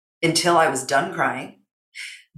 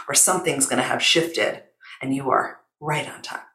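normal talking voice

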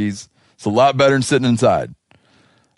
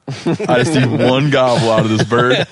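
ordinary delivery

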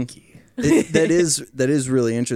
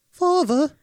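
put-on high, young pleading voice; younger voice, second try; 4 dB louder than usual. Second try at the younger voice calling father, high and young. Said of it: "let me try again".

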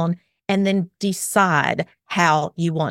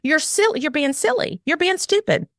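belittling parent; 9 semitones higher than usual. Retold or imitated. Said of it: "You're telling them".

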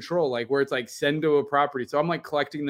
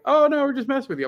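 mocking teasers; 10 semitones higher, 4 dB louder, much faster than usual. Mimics friends teasing her.

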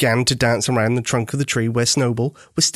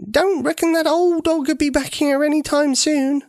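lightly voiced old lady, higher, chuckling; a hint of a granny laughing at the dog; old lady amused, wiping laughing tears. A narrator's reading. Granny Dear voiced in story.

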